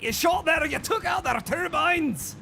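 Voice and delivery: high, excited Scottish brogue; Scottish accent. Acted. Streamer cheering a teammate's shotgun shot, in a high Scottish accent. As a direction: cry it out like a high-pitched Scotsman. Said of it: "It's a great accent".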